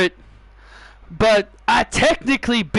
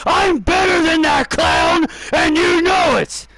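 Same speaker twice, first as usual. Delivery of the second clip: angry shout; 6 semitones higher than usual. Acted. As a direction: yell it back over the chant. Rival yells back.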